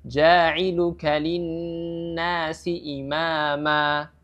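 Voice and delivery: ja'iluka linnasi imama very slow, ending on a held a; slow model of the pause form; long final a. Shown as a demonstration. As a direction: recite and stop on imama. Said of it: "Dengan mat di situ".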